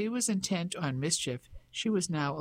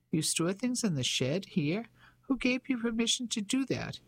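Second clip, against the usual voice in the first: haughty; 6 semitones higher than usual. A narrator's reading. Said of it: "She still sounded haughty".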